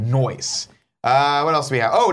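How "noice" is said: "Noise" as a playful "nice"; extra O. Shown as a demonstration. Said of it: "Two O's".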